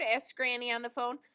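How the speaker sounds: ordinary delivery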